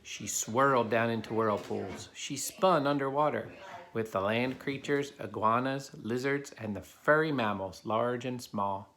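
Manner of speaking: put-on steady storybook narration for kids; reading a story aloud. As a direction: read it to the class like a picture book. Reads a picture book to children.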